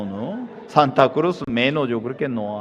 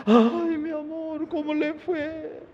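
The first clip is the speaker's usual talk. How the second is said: sweet-talking husband; 3 dB quieter, slower than usual. Acted. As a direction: gush over her like a changed man. Husband greets wife sweetly again.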